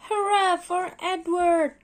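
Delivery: high, loud cheer; cried cheer. Acted. Donald cheering Edward up the hill, loud and high. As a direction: cheer him on with a big cry. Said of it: "cried Donald".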